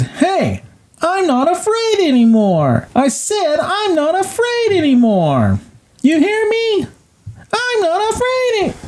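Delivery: very high little-boy voice, fake bravado; Kevin. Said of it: "and he says".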